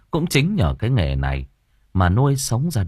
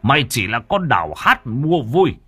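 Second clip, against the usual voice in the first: mad with rage; 5 semitones higher than usual. A narrator's reading. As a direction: berate her, voice climbing into fury. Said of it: "điên lại càng điên". (điên tiết mắng nhiếc)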